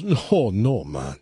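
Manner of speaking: relaxed Jamaican punchline, no man; laid-back Jamaican punchline; Jamaican accent. Acted. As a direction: deliver the punchline in easy Jamaican.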